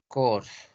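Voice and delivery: Corse repeated as "kors"; French Corse, silent E; French pronunciation. Shown as a demonstration. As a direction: say Corse as one French syllable. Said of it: "en francés es".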